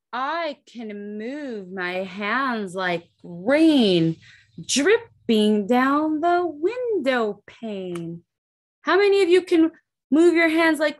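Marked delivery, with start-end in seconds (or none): 0.1-8.2 slow, rhythmic read-aloud verse; slow storybook reading. A narrator's reading. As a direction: read the rhyme slowly to children. Reads rhyming book aloud.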